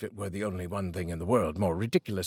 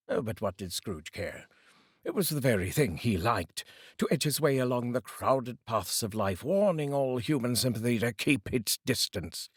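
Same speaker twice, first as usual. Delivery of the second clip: ordinary delivery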